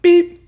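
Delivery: very high electronic beep; stud finder beep. Retold or imitated. Imitating stud finder beeping.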